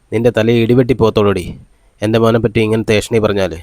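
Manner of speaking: ordinary delivery, nothing marked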